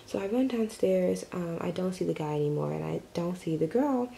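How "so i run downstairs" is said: ordinary talk, nothing marked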